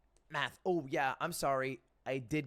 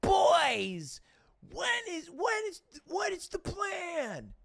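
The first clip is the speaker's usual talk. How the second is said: very high, loud frantic yell; panicked, demanding shout; 3 dB louder, much slower than usual. Retold or imitated. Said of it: "I can't walk into that call going".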